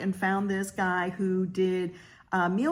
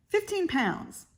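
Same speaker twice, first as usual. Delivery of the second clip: amazed; 11 semitones higher than usual. Real, not acted. Stunned repeat of husband's loss.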